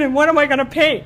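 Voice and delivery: high and loud, astonished; reliving her amazement loudly; disbelieving. Retold or imitated. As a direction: throw the question back in shock. Retold disbelieving question to God.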